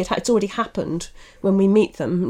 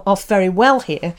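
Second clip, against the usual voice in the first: ordinary talk, nothing marked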